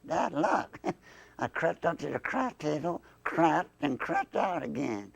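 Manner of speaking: stretched Southern puppet voice; Southern accent. Acted. Southern dummy recalls bad luck gambling, Southern drawl. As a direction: tell it in a broad Southern drawl. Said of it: "that accent".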